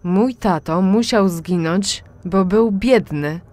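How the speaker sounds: loud, slow furious accusation; bitter accusation. A narrator's reading. Daughter accuses mother of father's death, loud and slow. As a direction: hurl it at her slowly.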